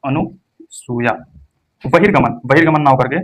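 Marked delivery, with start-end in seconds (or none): 0.0-1.1 अनुसूया drawn out slowly, each syllable clear; slow correct pronunciation. Shown as a demonstration. Teacher to exam students, slow model word. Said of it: "अनुसूया होता है".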